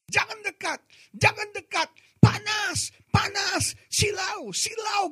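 very high-pitched frantic mock shriek; imitated scream. Possessed youth warning the preacher to keep away, imitated scream. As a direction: cry out high in short bursts, imitating panic. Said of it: "anak muda itu teriak".

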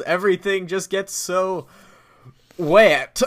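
mock old fellow fussing about a storm; lovely old man. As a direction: build to a hammed-up punch at the end. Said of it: "just a lovely old man".

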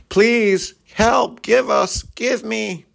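high, pleading mock beggar; begging voice. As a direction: plead like someone locked outside. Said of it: "on the other side of the fence going".

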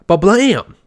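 high, loud exclaimed blam; punchy reveal sound effect. Retold or imitated. Imitating the Animal Crossing title reveal, dramatic blam.

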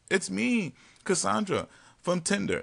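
high, light voice of the date; man imitating Cassandra, high and light; mock girl voice. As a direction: play her sweetly introducing herself. Voicing date introducing herself.

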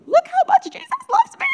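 put-on very high, loud child's shout; overjoyed child. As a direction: squeal it out like a thrilled little girl. Retold child running around overjoyed.

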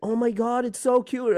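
high, gushing mock girl voice; girly gushing voice. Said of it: "they were just like".

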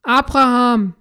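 loud, far-off calling voice; booming voice from sky. A narrator's reading. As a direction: call down from heaven. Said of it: "ruft eine Stimme vom Himmel".

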